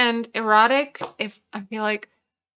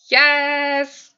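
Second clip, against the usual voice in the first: excited yes; 5 semitones higher, 6 dB louder than usual. Real, not acted. Student passed his exam.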